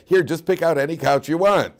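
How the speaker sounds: fed-up husband pushing his wife to choose; exasperated husband. Retold or imitated. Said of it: "and you say".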